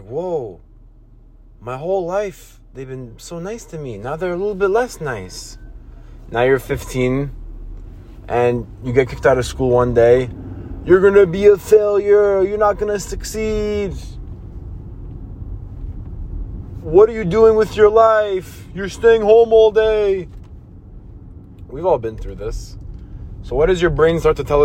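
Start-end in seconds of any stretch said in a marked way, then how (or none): 0.0-5.6 a much softer, deflated inner voice; disappointed kid. Retold or imitated. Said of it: "And you're like". 10.8-20.3 scolding adult. Retold or imitated. Adults scolding a kicked-out teen.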